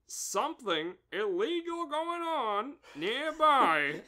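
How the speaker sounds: a hint of a goofy inspector; character voice. Reporting trouble to Bob in a put-on voice.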